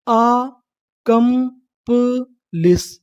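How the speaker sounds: आ, कम, प, लिस drawn out very slowly; syllable by syllable. Shown as a demonstration. Teacher assembling the word for learners, slow syllable by syllable. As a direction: separate आ, कम, प, लिस with long vowels and gaps. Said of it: "देखिए meaning क्या बनी".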